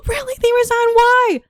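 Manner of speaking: put-on high, loud astonished kids; imitating kids. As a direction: squeal like amazed children. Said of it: "all the kids are like".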